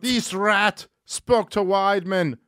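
high, slow, hammed-up Irish accent; bad Irish accent. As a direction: belt out a badly faked Irish accent, stretched out. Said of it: "Can you do an Irish accent?".

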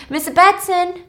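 high, loud acted call. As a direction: call for her through the house. Virgil calls into the silent house.

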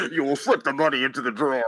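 menacing disguised ransom voice; anonymous hacker voice. Pretend. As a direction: read the demands like a masked villain. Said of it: "Go full anonymous here".

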